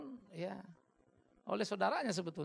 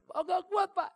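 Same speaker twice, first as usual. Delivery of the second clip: put-on child pleading; 12 semitones higher, 7 dB louder, slower than usual. Child's plea voiced.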